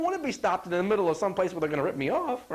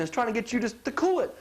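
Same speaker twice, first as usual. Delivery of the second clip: ordinary talk, nothing marked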